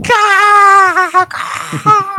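high cawing bird call; crow caw. Acted. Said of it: "I know you know what those guys going".

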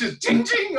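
high laughing ting ting; mock cash register ting. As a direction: ring it out like a till, laughing. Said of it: "every time you flush".

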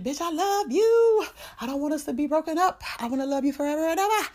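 high, loud hammed-up pleading; mock lovesick wailing. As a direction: wail it like a desperate lover, stretching the ends. Lover pleading not to break up.

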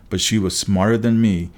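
ordinary delivery

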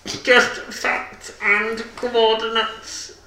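high, slow, stilted voice; deadpan high character voice; odd musician character. Acted. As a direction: drag it out in a high put-on voice. Odd musician character refuses request.

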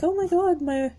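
high-pitched mock-anxious horse; mock panic, starting high and easing down; worried horse. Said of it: "how some horses are like".

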